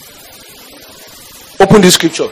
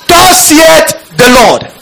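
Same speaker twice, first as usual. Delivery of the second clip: prophetic proclamation; 8 semitones higher, much slower than usual. Acted. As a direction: thunder out "Thus saith the Lord".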